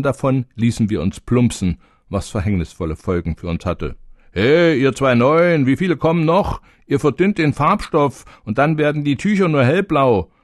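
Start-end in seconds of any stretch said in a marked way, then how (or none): 4.3-10.3 put-on old drops scolding. A narrator's reading. Old drops scolding the newcomers in the vat. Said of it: "spritzten uns die bereits vorhandenen Tropfen entgegen".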